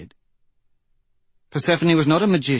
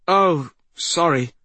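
embarrassed; 7 semitones higher, 4 dB louder than usual. Acted. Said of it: "Aryan looked embarrassed".

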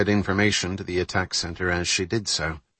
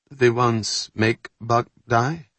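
put-on accented English; 4 semitones higher, slower than usual. Carol asks slowly.

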